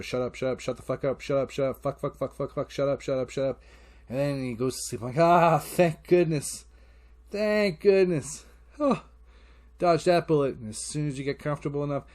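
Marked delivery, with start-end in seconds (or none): 0.0-3.6 even, level string of repeated curses; repetitive inner mantra. Retold or imitated. Dad willing stirring kid asleep, chanting. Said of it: "In my head, it's my mantra". 5.2-10.6 loud, drawn-out sighs of relief; hammed-up relief, high and slow; relieved. Dad after kid falls asleep, hugely relieved.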